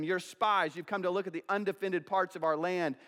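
ordinary delivery

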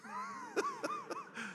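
a high, breathy burst of laughter. Real, not acted. Preacher laughing at his late dad's joke, high laugh. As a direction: crack up at the punchline.

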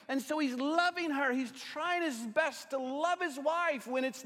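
high, loud preacher's voice voicing Hannah's anger; preaching at full force. Pastor preaching to the congregation about Hannah, full force. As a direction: preach it out, raising the voice over the room.

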